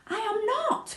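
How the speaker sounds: put-on high, indignant boy's protest; cried out. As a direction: insist eagerly that it's true. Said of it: "cried Charlie".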